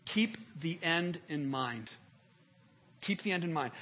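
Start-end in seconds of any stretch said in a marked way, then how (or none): 0.0-1.9 slow emphasis. Shown as a demonstration. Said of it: "Here it is".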